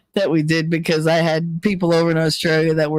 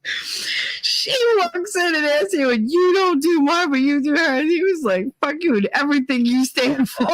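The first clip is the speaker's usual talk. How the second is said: high voice, nearly crying with laughter; retold outrage, dissolving into giggles; laughing retelling; 9 semitones higher than usual. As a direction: squeak out the story through helpless laughter. Helpless laughter retelling the quarrel.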